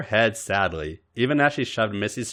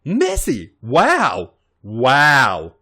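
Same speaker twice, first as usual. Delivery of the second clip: higher, louder, thrilled wow; amazed gasp; 4 semitones higher, 8 dB louder, much slower than usual. Acted. Robin seeing her makeup in the mirror, thrilled. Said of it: "Robin gasped".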